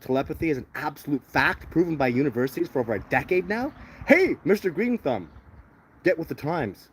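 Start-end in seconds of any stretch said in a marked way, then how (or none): 4.1-6.9 mocking call-out. Real, not acted. Taunting a commenter by name.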